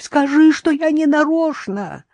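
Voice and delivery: high, drawn-out plea; pleading. A narrator's reading. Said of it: "умоляла свекровь". (жалобно умоляя)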